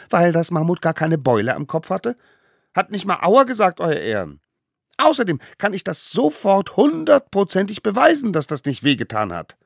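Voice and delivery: put-on high, cheeky child-figure voice; cocky little defendant. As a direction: argue back smugly to the court.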